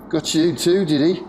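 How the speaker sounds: loud, high booming Scouse; thick Scouse accent. A narrator's reading. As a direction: call out in broad Scouse. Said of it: "beaten by a thick Scouse accent".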